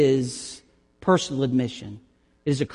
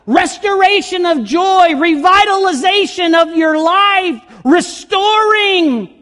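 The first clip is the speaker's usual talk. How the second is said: much higher and louder, ringing and jubilant; preaching at full force; 11 semitones higher, 6 dB louder than usual. Acted. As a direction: shout the blessing out to the congregation. Preaching climax on forgiveness.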